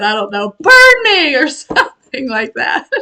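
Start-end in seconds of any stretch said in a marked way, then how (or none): 0.6-1.3 high, louder mock yell; retold yell. Retelling the heroine daring the dragon, hammed-up high yell. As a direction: shout it like the cornered heroine. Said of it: "she just yelled at the dragon".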